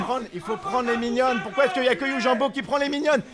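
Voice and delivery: esports caster. Real, not acted. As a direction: call the action with urgency. Live play-by-play cast.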